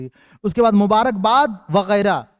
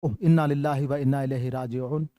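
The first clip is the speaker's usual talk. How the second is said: softer, lower sorrowful response; condolence; 7 semitones lower, 6 dB quieter than usual. Shown as a demonstration. Teacher modeling a reply to news of a death, subdued. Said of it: "موت کی خبر سنا دی تو آپ نے کہا".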